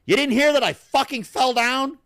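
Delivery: imitating her, high and loud; indignant. She tells the guys she fell, high and indignant. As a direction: act her upset disbelief. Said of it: "She goes".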